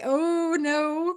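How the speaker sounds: lightly acted dismay at a mishap; mock dismay. Streamer acting out smudging the ink, mock dismay.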